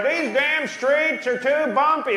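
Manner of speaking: high-pitched, drawn-out grumbling grandma; imitated grandmother. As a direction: complain slowly in a high grandma voice. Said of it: "she'd be like".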